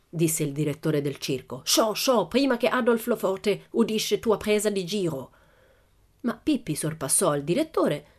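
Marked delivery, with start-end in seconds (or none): 1.6-5.3 put-on high, fussy ringmaster with foreign accent; irritated foreign showman, raised pitch; circus director. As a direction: shoo the girl away in broken Italian. Angry director shoos Pippi away.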